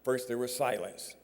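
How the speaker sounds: ordinary talk, nothing marked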